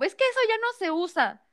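high, mocking nag; lightly mocking whine; mocking quote. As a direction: mimic them scornfully. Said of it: "empieza así como".

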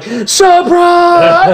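crowd shout. Retold or imitated. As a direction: yell it like the whole party. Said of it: "they were like".

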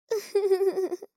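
bright little giggle up close; a short, pleased giggle; happy giggle. Acted. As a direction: giggle with delight at the hug. Giggle after hugging.

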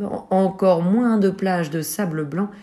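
plain reading voice

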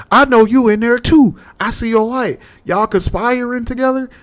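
very high, screechy mock female voice; angry woman at the door. Woman at the locked door accusing the roommate, high mock rant.

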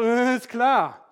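slow, dismissive sarcasm; sarcastic scoff. Retold or imitated. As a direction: scoff at their news. Thomas scoffs at disciples.

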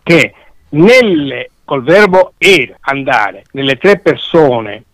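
usual speaking voice

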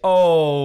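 long, loud mock "ohhh"; imitated reaction cry. Imitating Changu's reaction for the podcast guys, loud drawn-out cry. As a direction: hold one big, loud exclamation, imitating someone. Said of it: "Changu będzie takie".

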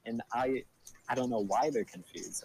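ordinary talk

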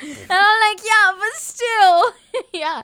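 high, loud, drawn-out protest through laughter; laughing protest. Retold or imitated. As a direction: protest weakly while cracking up. Cracking up over mom's joke.

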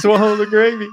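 high, loud, laughing through the line; laughing quote. Retold or imitated. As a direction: crack up while quoting. Friend repeats quote laughing.

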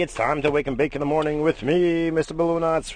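loud, high wake-up show opener; big cheery host intro; show host greeting. Acted. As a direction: greet the audience like a morning radio host.

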